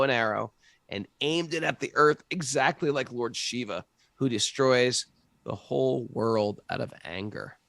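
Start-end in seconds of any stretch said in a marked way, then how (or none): none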